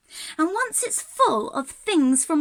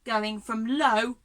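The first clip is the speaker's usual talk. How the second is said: ordinary talk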